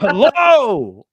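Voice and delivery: sudden high furious yell of hello; retold angry scream. Dad yelling hello into a phone on the table. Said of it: "just yelled out".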